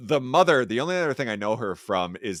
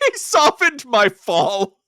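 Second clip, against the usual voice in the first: mock hero line; 12 semitones higher, 7 dB louder, much slower than usual. Mocking the movie hero's line.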